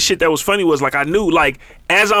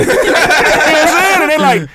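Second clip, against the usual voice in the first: high, loud, slow laughing question; 8 semitones higher, 4 dB louder, slower than usual. Real, not acted. Telling friends he was high, laughing hard. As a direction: crack up while asking.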